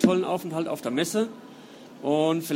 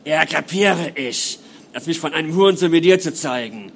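evil, furious voice; 7 dB louder than usual. Acted. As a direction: spit the words out like a possessed woman. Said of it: "wie ihre Stimme, die jetzt sehr böse klang".

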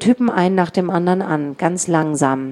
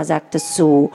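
ordinary delivery, nothing marked

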